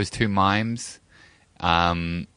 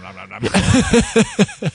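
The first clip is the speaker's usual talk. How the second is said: cartoon nom nom; 10 semitones higher, 7 dB louder, much slower than usual. Imitating animated faces chewing.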